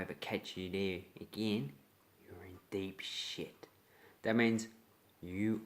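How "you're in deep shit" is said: slow, threatening whisper; menacing whisper. Acted. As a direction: breathe it slowly as a warning. Threat to a trespasser.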